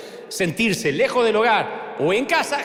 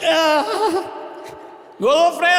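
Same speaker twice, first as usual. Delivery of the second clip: laughing; 11 semitones higher than usual. Real, not acted. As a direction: burst out laughing. Preacher cracks up laughing. (riéndose a carcajadas)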